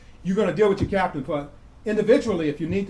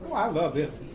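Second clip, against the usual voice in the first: ordinary talk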